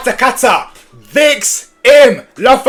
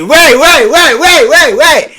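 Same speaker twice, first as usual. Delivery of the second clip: high, loud panicked waits; rapid alarmed wait wait wait; being chased; 8 semitones higher, 6 dB louder, much slower than usual. Real, not acted. Streamer panics watching chase.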